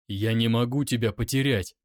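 ordinary delivery, nothing marked

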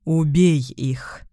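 slow, low monster voice; monster command. Acted. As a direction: growl the order slowly. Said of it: "вдруг приказал монстр". (медленный низкий голос чудовища)

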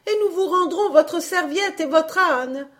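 high, loud pleading hostess; crying out. A narrator's reading. Hostess offering back towel and donkey, crying out high. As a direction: beg frantically in a high voice. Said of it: "cria l'hôtesse".

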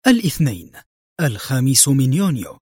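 plain reading voice

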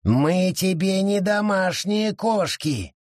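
high, slow scornful creature voice; scornful forest creature; contemptuous retort. A narrator's reading. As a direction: cut them off with a sneer. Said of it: "презрительно усмехнувшись, отрезал лес".

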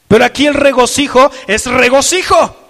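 loud emphatic declaration; emphatic shout. Real, not acted. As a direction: proclaim it full voice. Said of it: "así es como yo manifiesto". (declaración a pleno pulmón)

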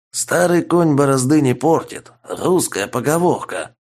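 grasseyed r, rolled in the throat; burred r. A narrator's reading. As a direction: say r as a French-style burr. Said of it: "старательно прокартавил он". (картавя, с грассирующим р)

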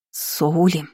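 name said with understated menace; stormy undertone. A narrator's reading. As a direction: warn by name alone. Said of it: "В голосе Лины звучали грозовые нотки". (с грозовыми нотками)

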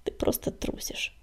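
soft, low-volume inner remark; quiet private verdict; silent thought. A narrator's reading. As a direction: murmur it to yourself. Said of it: "добавил про себя Огнегриф".

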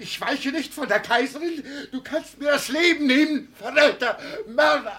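high, loud, frantic defiance; defiant shout. Acted. Old man screams defiance at his captors. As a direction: scream it as he is seized.

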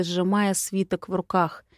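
ordinary delivery, nothing marked

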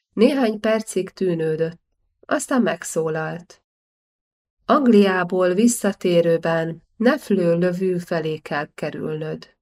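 ordinary delivery, nothing marked

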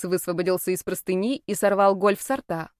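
ordinary delivery

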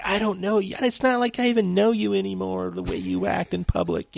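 high, put-on accusing girlfriend; hammed-up relationship talk; mock girlfriend voice. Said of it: "It's like".

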